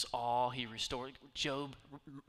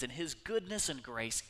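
ordinary talk, nothing marked

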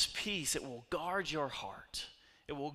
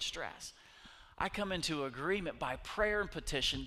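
raised, pressing preacher's voice; fervent preaching; 3 semitones higher than usual. Acted. Preacher leads the church in fervent, raised prayer. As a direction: pray it out over the room with fire.

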